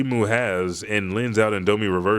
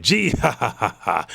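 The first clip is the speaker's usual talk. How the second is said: higher, louder gloating cackle; mocking villain laugh; 3 semitones higher, 3 dB louder than usual. Shiki gloating over Gonzu, a higher, louder villain laugh. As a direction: laugh down at the beaten foe.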